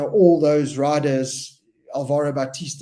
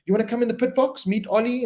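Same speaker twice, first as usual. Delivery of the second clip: much higher, chirpy mock Troy Bayliss; lightly imitated delighted Troy; imitating Troy; 9 semitones higher, faster than usual. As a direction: pitch up to play the grateful rider. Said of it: "He said".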